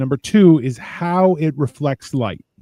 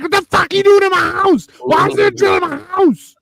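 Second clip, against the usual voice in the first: angry underground dweller; 12 semitones higher, faster than usual. Retold or imitated. Underground man yelling at the Russian drillers, indignant. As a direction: yell like someone whose house got drilled. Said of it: "he's like".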